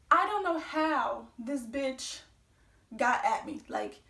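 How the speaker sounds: ordinary talk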